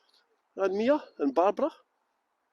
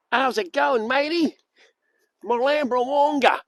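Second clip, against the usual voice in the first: slightly raised, jokey Australian voice; mock Australian accent; 5 semitones higher than usual. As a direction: put on a playful Australian twang. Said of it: "That's Australian for sheep dip".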